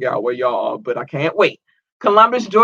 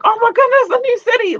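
much higher, squealing delight; excited; 3 dB louder than usual. Retold or imitated. Host imitates herself spotting a new city, high and excited. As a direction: gush it high like a happy surprise. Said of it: "I get excited".

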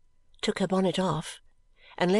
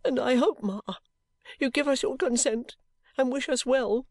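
high, halting plea; tearful pleading; 6 semitones higher than usual. A narrator's reading. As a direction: beg timidly for mother's blessing. Tearful daughter pleading with mother.

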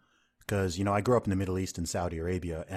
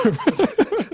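a sudden high laugh; 6 dB louder than usual. Real, not acted. Guest laughing at his own sarcasm, high.

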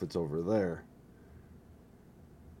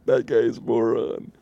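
mocking heckler; 4 semitones higher, 7 dB louder than usual. Mocking voice of critics.

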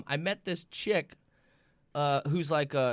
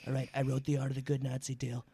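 Trump impression; 5 semitones lower than usual. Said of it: "so that's a little".

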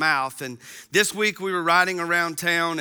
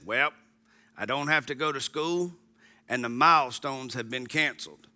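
little froggy voice; 5 semitones lower, 4 dB quieter than usual. Retold or imitated. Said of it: "in his little froggy voice".

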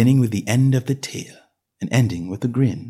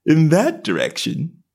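lazy cat voice; 5 semitones higher, slower than usual. A narrator's reading. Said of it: "the cat said".

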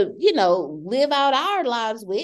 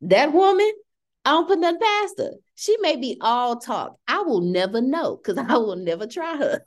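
ordinary talk, nothing marked

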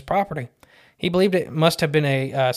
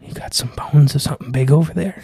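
Smithsonian voice; 4 dB louder than usual. Pretend. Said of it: "I could just hear the Smithsonian go".

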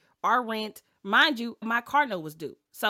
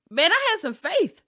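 excited; 8 semitones higher, 8 dB louder than usual. Real, not acted. Passionate about her leap of faith.

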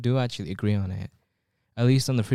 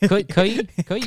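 mocking; 9 semitones higher, 4 dB louder than usual. Mocking CCP permission.

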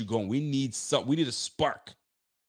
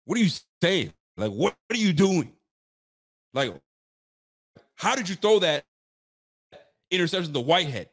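imitating a coach chewing out; 3 semitones higher, 4 dB louder than usual. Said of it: "Are you actually gonna tell me".

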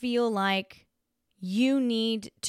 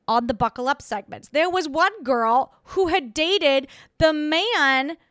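ordinary talk

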